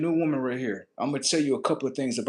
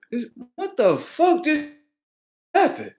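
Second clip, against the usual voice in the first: baffled man; 4 dB louder than usual. Retold or imitated. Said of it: "Motherfucker look at me like".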